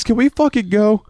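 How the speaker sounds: fed up, wanting to go home; exasperated yell. Retold or imitated. Narrator begging Wes to leave, high exasperated shout. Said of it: "begging Wes to leave".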